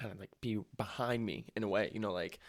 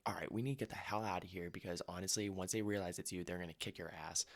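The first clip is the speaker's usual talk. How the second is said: hushed warning; 2 semitones lower, 8 dB quieter than usual. Retold or imitated. Buddy hiding in the bathroom, hushed urgent warning. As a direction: keep it down so the frat guys can't hear.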